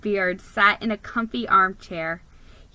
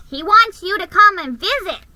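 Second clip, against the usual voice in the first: high, loud excited elf; 9 semitones higher, 8 dB louder than usual. A narrator's reading. Elf inviting the mouse to visit Santa, high and bright. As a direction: chirp it like an eager little elf. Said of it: "the elf explained".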